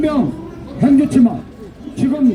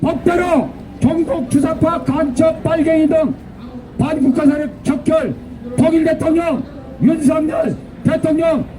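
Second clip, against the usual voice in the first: shrill echoing propaganda broadcast; 3 semitones higher than usual. Real, not acted. Rally chant leader.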